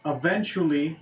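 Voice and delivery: usual speaking voice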